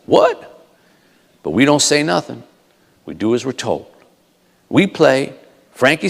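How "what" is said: high-pitched baffled question; baffled reaction. Acted. Band members react to the colonel's order, baffled. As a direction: squeak it out in disbelief. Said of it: "we look at each other like".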